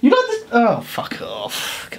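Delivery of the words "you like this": high disbelieving question; incredulous. Real, not acted. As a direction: squeak out your disbelief. Shocked at her taste.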